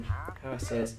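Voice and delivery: ordinary talk, nothing marked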